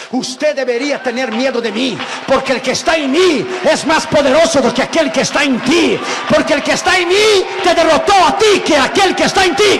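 rising to a full, loud defiant shout. Retold or imitated. Preacher defying the satanist leader on the phone, rising shout.

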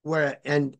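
ordinary delivery

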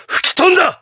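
sharp high-pitched cry; triumphant exclamation. Acted. President seeing the harm sent far away, exultant cry. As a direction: burst out with it in triumph.

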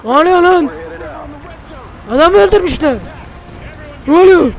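loud startled yelling; high alarmed cries of what's going on; alarmed shout. Real, not acted. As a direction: shout in real panic as shooting starts. Sudden attack in game.

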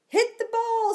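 high loud ballpark cheer; high shouted cheer. Acted. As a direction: cheer from the stands. Cheering at ball game.

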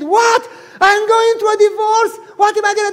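high, loud, shocked protest; loud shocked wail; shocked outcry. Retold or imitated. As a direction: cry out in disbelief. Lady shocked by harsh advice.

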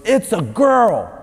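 high, drawn-out mock announcement; imitated doctor calling out slowly; loud doctor. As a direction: proclaim it like a triumphant doctor. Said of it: "the doctor says real loud".

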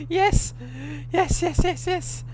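very high shrieked yeses; shrieking jackpot winner. Real, not acted. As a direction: scream with disbelieving joy. Puzzle works, shrieking joy.

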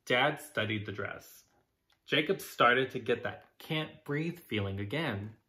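usual speaking voice

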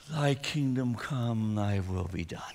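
very soft and slow, breathed out; slow breath prayer. Shown as a demonstration. Pastor modelling a breath prayer for the church, soft and slow. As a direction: let each word ride the exhale. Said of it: "practice three breath prayers with me".

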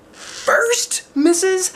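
very high, loud astonished squeak; thrilled amazed child; gasping surprise. A narrator's reading. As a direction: gasp in disbelief. Gasp at exciting news.

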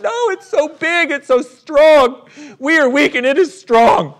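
high, loud wailing objection, drawn out; hammed-up despair at an overpowering foe; mock helpless protest. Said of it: "They're like".

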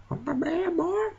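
On my bad boy in a very high squeak; squeaky repeat. Shown as a demonstration. Gamer repeating a phrase about his tank in a high squeak. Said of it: "speaking in this accent".

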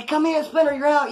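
high, bright welcome; happy greeting. Retold or imitated. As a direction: greet him like he's finally home. Friend's wife greeting him happily.

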